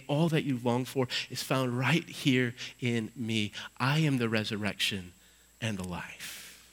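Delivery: soft, intimate words of Jesus; tender hush. Acted. Jesus gently assuring grieving Martha, soft and tender. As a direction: speak it gently, as if to a grieving friend.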